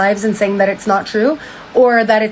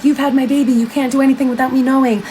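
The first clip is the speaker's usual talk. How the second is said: higher-pitched imitated outrage; mock indignant, voice pitched up; retold freak-out; 4 semitones higher than usual. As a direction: scold like a possessive partner, lightly acted. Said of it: "He freaks out".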